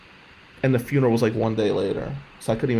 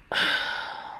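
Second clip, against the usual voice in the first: heavy sigh; 7 dB quieter than usual. Real, not acted. Grief sigh.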